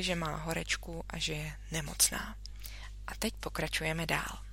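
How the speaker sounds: ordinary delivery, nothing marked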